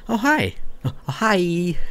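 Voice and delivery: put-on high, bright girl's greeting; light girlish voice; Slavia's voice. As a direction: pitch up into a cheery young girl.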